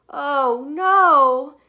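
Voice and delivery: loud, high cry of dismay; dismayed mother. Acted. Mother seeing the colors come back, crying out. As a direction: shriek at the rainbow hands. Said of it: "said her mother".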